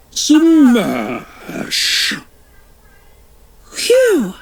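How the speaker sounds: high crashing cry then a relieved phew; cartoon crash and relief. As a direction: smash it, then sigh out phew.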